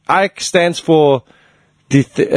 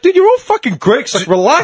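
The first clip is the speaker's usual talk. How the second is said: exasperated plea; 7 semitones higher than usual. Retold or imitated. Exasperated plea to brawling fans.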